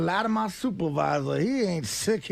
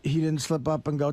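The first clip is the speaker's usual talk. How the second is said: usual speaking voice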